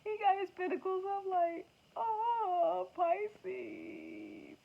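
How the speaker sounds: high, crying mock lament over Pisces; tarot figure pining for Pisces, high mock weeping; sad lovesick figure. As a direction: wail it like a heartbroken card character. Voicing a sad card figure.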